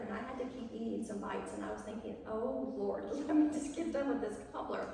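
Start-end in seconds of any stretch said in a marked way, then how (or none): 2.3-4.9 a laughing, pained inner groan as she forces down the salty cobbler; laughing dread. Retold or imitated. Granddaughter stuck eating salty cobbler, laughing dismay. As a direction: laugh through the thought of suffering politely.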